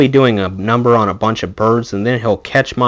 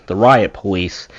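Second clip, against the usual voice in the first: ordinary delivery, nothing marked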